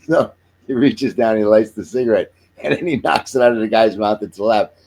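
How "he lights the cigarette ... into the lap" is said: ordinary delivery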